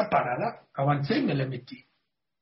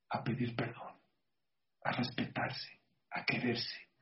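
much softer, near whispered lesson; soft moral; 3 semitones lower, 11 dB quieter, slower than usual. Real, not acted. Preacher to congregation, hushed and tender moral. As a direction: drop to a hush to drive the lesson home. Sermon moral softly stated.

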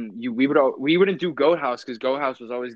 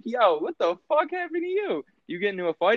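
quoted friend; 9 semitones higher than usual. Retold or imitated. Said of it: "Mark's like".

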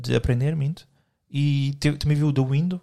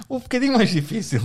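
higher, laughing, mock exasperated plea; laughing exasperated repeat; 8 semitones higher, 3 dB louder than usual. To his co-host who gave an easy sum, laughing higher. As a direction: laugh it out, pleading for harder.

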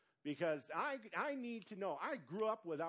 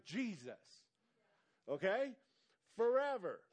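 ordinary delivery

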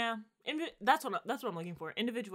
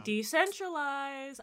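very slow, stretched-out word, pitched up; drawn-out plea; 5 semitones higher, much slower than usual. Real, not acted. Telling co-host the internet should split by region, long drawn-out plea. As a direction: draw it right out like a slogan. Repeats pet demand as a slogan.

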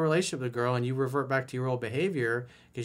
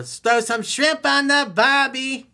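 slow, high, loud hammed-up Australian accent; bad Australian accent; 11 semitones higher, 9 dB louder, slower than usual. Coach jokingly slipping into Australian accent, hammed-up. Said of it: "Your Australian accent really sucks, coach".

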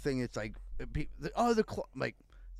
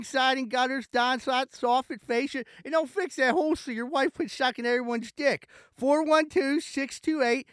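higher, pushy radio-ad pitchman voice; high-pitched mock ad read; 10 semitones higher, 4 dB louder than usual. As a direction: sell it like a cheesy local TV commercial. Parody ad read on podcast.

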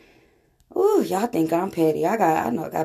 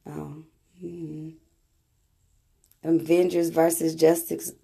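ordinary delivery

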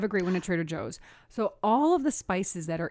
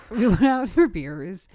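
laughing; 3 semitones higher, 7 dB louder, slower than usual. Real, not acted. Laughing retelling first date.

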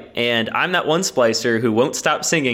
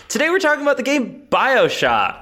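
announcer voice; 13 semitones higher than usual. Pretend. Announcing the game theatrically.

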